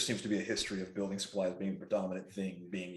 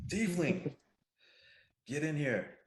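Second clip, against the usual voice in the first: high, loud summoning call; yelling out; 8 semitones higher, 5 dB louder than usual. Acted. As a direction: holler them over in character. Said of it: "I yell out there".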